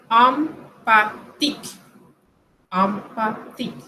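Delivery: slow "Empathique", opening on nasal "am"; French pronunciation demo. Shown as a demonstration. Teacher showing the EM sound, slow French pronunciation demo. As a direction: split "Empathique" into am-pa-tik, slowly. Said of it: "Con el E M".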